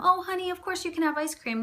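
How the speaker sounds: a mild gush of an indulgent parent; permissive loving parent. Shown as a demonstration. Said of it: "or saying to them".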